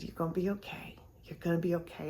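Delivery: soft whisper, very quiet; whispered comfort. Retold or imitated. Holding a crying woman, whispering reassurance to her. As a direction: whisper it into her ear to soothe her.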